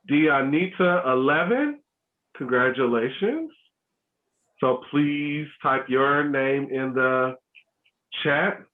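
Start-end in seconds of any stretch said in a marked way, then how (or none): 4.6-8.6 slow, spaced-out instruction; slower for translation. Real, not acted. Host guiding raffle winners through the translation, slower. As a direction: say it slowly, with pauses between phrases. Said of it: "make sure I say it slower".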